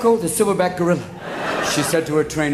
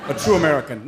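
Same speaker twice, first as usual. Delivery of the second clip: ordinary talk, nothing marked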